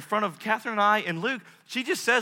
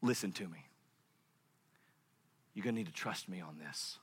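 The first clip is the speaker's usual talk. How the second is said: hushed, low, near whisper; steady a frightened child softly; calm voice of God; 10 semitones lower, 12 dB quieter than usual. Acted. God calmly answers.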